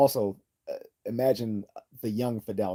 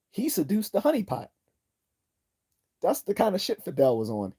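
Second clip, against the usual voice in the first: amazed punchline; 10 semitones higher, 4 dB louder than usual. Real, not acted. Amazed at Castro story.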